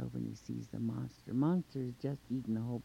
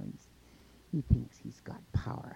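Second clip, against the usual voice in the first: ordinary delivery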